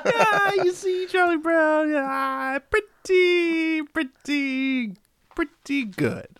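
high, slow put-on Larry David voice; drawn-out pretty, pretty good; Larry David impression. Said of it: "Martin Short playing Larry David".

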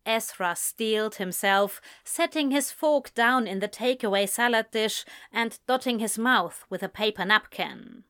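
ordinary delivery

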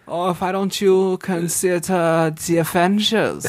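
put-on lightly done German accent; a hint of German in the vowels. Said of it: "when I switched into my German accent".

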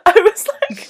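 high, loud, laughing disbelief; incredulous laughter. Real, not acted. Cracking up over the weirdest choice in the film, incredulous laughter. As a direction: crack up while telling it.

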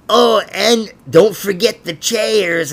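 high, slow, snotty coworker voice; mock bossy girl. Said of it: "she goes".

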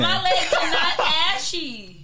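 slow, high insistence through laughter; laughing protest. Real, not acted. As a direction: stretch each word in amused denial. Teased about ashy legs, protests.

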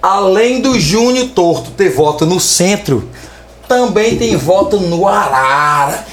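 normal talking voice

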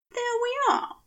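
high, fussy angel voice; prim high angel settling into bed; Aziraphale's voice. A narrator's reading. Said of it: "he said".

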